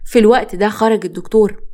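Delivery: plain reading voice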